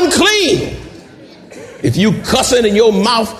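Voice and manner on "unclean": high hollered unclean. Shown as a demonstration. Preacher telling drug users to holler, high holler. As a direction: holler it out like a leper. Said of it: "Holler out".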